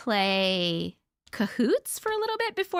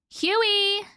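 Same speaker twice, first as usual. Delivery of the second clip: sharp call to the dog, pitched up; 6 semitones higher than usual. Real, not acted. Streamer calls out to her barking dog, sharp call. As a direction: call the dog's name to stop him.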